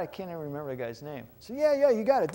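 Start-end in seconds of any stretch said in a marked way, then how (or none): 1.5-2.3 eager agreement. Retold or imitated. Retold eager agreement again.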